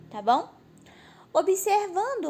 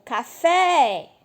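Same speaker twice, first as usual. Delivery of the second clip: café with FÉ loud and held long; stress on final syllable; 7 dB louder than usual. Shown as a demonstration. Teacher showing the class the strongest syllable, stressed café. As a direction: call out café, stretching the final fé. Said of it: "nem precisava chamar no portão".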